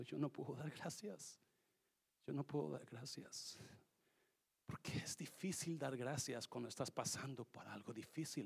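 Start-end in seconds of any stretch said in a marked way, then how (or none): 0.0-3.6 soft retold tearful plea; retold crying. Pastor retelling his daughter's grief, softly tearful. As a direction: quietly echo a daughter's tears. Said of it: "dice llorando".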